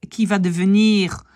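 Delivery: normal talking voice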